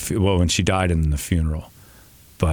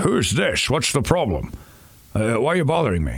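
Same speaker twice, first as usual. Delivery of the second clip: grumpy Scottish voice pitched up; a grumpy Connery disturbed on the phone; Sean Connery impression; 6 semitones higher than usual. Caller pranking him, grumpy Scottish Connery voice. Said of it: "Sean Connery on the phone would be like".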